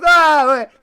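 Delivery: loud, high wail; imitating a loud objection; imitated protest. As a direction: cry out like an aggrieved fan. Retelling klaxon man's loud protest.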